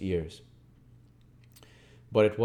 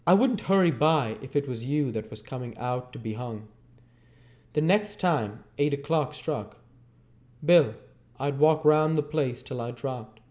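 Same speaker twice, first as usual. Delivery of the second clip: put-on higher, lighter young woman's voice; Nancy sighing to Bill over the condemned lads; Nancy's voice; 6 semitones higher than usual. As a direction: play Nancy, wistful over the young men.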